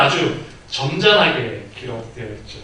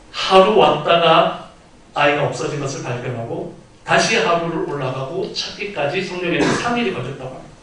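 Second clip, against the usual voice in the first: ordinary talk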